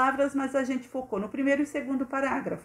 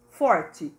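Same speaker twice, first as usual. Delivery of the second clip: "forte" louder, after a short pause; stressed, strong; 5 semitones lower, 5 dB louder than usual. Shown as a demonstration. Teacher explaining the strong R, "forte" stressed louder. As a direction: land hard on "forte". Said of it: "eu falei forte".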